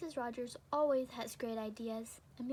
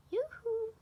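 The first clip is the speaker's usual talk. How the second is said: high, loud yoo-hoo call from far away; 12 semitones higher, 9 dB louder than usual. Acted. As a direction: call out across the yard in a sing-song. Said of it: "called Missus Rogers from the kitchen window".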